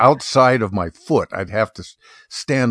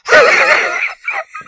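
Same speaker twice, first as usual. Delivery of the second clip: high, airless wheezing laugh; wheezy laugh imitation; 4 dB louder than usual. Mocking the podcast host's laugh with a high airless wheeze. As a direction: mimic a breathy wheeze of a laugh. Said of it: "He's like".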